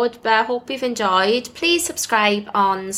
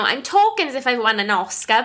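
ordinary delivery

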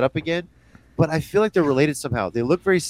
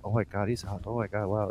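low, hushed admiration; soft imitated swoon; retold awe; 6 semitones lower, 13 dB quieter than usual. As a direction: murmur it as if struck by his looks. Retells girlfriend's swooning reaction.

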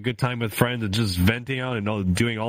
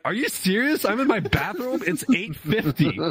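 high-pitched, incredulous comic protest; exasperated disbelief; 11 semitones higher than usual. Retold or imitated. Streamer retelling her reply to husband, exasperated. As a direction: protest in mock outrage, voice way up.

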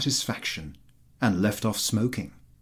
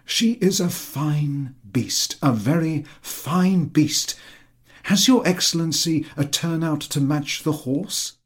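lightly voiced fawning landowner, higher; eager flatterer; 6 semitones higher, 4 dB louder than usual. A narrator's reading. Chertakutski flattering the general about his horse, eager and higher. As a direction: fawn over the horse in a raised, eager voice.

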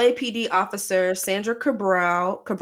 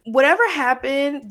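normal talking voice

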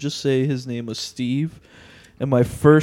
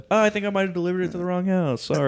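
higher-pitched, hurried mock apology; feigned innocent apology; 6 semitones higher, much faster than usual. Dasher excusing a stolen order, feigned innocent apology. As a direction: rattle off a high, fake-innocent excuse.

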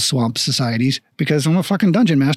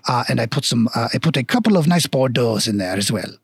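generous mock-French shopkeeper; bad French accent; 4 semitones lower than usual. Dwarf shopkeeper hands food to gentlemen, bad French accent. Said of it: "very shitty French accent".

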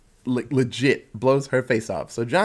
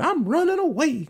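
higher, whiny mock runaway line; lightly mocking teen whine; bratty teen; 14 semitones higher than usual. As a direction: mimic a sulky teen storming out. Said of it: "he's gonna do that".

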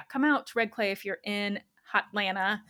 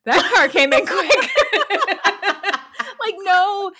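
louder and higher, laughing between words; 10 semitones higher, 9 dB louder than usual. Real, not acted. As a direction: crack up at your own yelp. Laughing at her own outburst.

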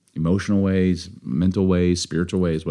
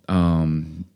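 ordinary talk

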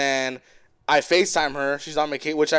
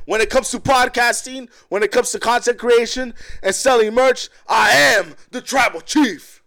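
wrestling promo; 10 semitones higher, 6 dB louder than usual. Pretend. Host hamming up a wrestling promo about merch.

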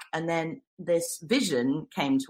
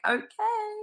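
high-pitched, drawn-out, doubtful "okay"; mock squeaky unease, much higher than usual; dubious okay. Newcomer reacting to festival strangers' long hugs, dubious.

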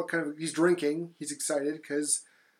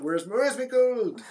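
leprechaun demanding his gold; 7 semitones higher, 5 dB louder, slower than usual. Retold or imitated. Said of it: "he's trying to find the gold".

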